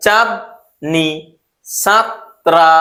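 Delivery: very slow, each syllable apart; teacher slowly breaking names into syllables. Shown as a demonstration. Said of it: "যেমন এর আগে কি বললাম".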